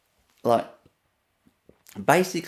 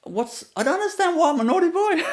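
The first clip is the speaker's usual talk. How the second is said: put-on bewildered kid; 12 semitones higher than usual. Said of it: "I looked at her like".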